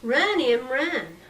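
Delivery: urgent yell to her sister to run; loud frantic call to run; screaming. A narrator's reading. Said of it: "screamed Laura".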